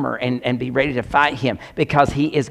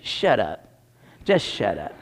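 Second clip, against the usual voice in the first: low, soft, falling put-down; low dismissive retort; 7 semitones lower, 5 dB quieter, slower than usual. Acted. Preacher answering the excuse-makers, low mock dismissal. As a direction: drop it under your breath.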